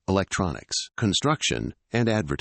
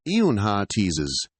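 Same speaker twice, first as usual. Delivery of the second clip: ordinary delivery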